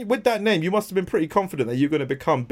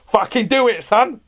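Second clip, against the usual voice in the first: louder, much higher mock threat; mock gunman; 10 semitones higher, 6 dB louder than usual. Host playing gunman to guest, mock threatening demand. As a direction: play at ordering someone at gunpoint. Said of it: "say if I got the gun".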